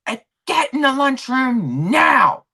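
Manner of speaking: loud, high, drawn-out command; retold boss losing it; meltdown order. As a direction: yell it, stretching the final word. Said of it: "I had a meltdown".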